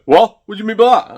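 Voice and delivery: loud, high mock indignation; affronted retort. Reseller answering the seller's insult, affronted. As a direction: imitate someone taken aback and offended.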